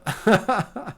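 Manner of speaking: short amused chuckle; real light burst of laughter. Real, not acted. Streamer puzzled by a pasta name, laughing.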